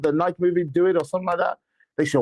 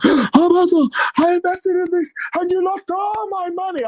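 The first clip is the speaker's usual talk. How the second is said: distraught plea; 11 semitones higher than usual. Retold or imitated. Investor wailing to the apostle about lost money, high distraught plea. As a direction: wail it like a ruined investor. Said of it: "they were breaking down".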